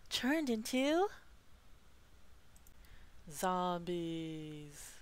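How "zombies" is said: low, drawn-out creepy reveal; spooky low voice. Acted. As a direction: drop the voice deep and eerie. Spooky reveal of zombie hamsters.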